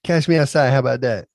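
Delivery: high, loud teen slang put-on; dad using teen slang loudly on his kids; mocking teen lingo. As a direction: ham up the kids' slang back at them. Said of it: "use their lingo back at them".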